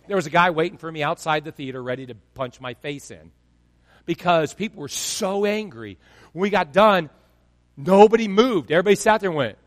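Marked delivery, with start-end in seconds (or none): none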